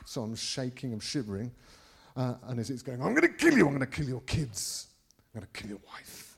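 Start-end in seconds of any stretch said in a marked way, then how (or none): 3.0-4.9 threatening demon. Retold or imitated. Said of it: "it's trying to intimidate me". 5.2-6.3 low, hushed demonic threat; threatening demon. Retold or imitated. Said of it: "Like this".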